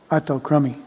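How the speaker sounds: ordinary delivery, nothing marked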